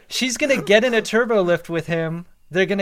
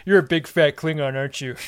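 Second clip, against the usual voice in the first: creepy Buffalo Troi voice; 3 dB louder than usual. Pretend. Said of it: "Buffalo Troi voice is so creepy".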